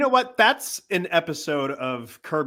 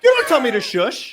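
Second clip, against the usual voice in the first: loud, high indignant retort; hammed-up high-pitched outrage; Larry David; 9 semitones higher, 5 dB louder than usual. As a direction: play an affronted sitcom grouch pushing back. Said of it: "on Larry as he's just like".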